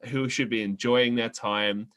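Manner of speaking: normal talking voice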